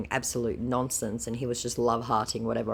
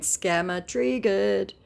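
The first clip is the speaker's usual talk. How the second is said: slow taunt; 6 semitones higher, 3 dB louder, slower than usual. Acted. Laughing taunt.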